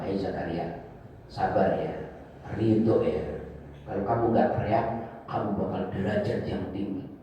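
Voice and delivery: low, soft, measured reassurance; calm consoling. Acted. Voicing God consoling Zakaria.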